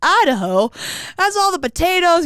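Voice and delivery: "Idaho?" jumps up high and loud in disbelief, then potatoes; hammed-up baffled squeal; mock disbelief. Telling a friend how she first heard of Idaho, in mock high-pitched disbelief. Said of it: "And I was like".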